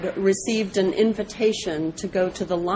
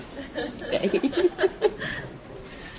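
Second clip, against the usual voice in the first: a rising airy laugh after the moral; breathy laugh; 9 semitones higher, 5 dB quieter than usual. Real, not acted. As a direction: chuckle at the moral.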